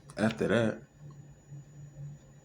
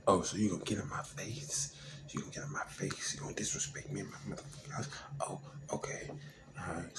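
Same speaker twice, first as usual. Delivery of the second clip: soft, breathy threatening whisper; hushed menace; 8 dB quieter, faster than usual. Retold or imitated. Girlfriend daring him in her house, in a menacing whisper.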